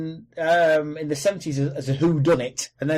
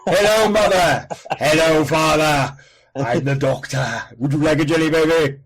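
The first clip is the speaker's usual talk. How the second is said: Tom Baker voice; 2 semitones higher, 6 dB louder than usual. Retold or imitated. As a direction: boom like a newborn Tom Baker. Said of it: "Tom Baker sounded like that from the womb".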